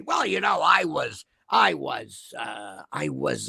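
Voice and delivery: hint of a hesitant, low mumbler, trailing off; loose Russell imitation. Commentator mimics Russell fumbling an excuse, lightly mocking hesitancy. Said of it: "I'm not gonna do his accent, but basically".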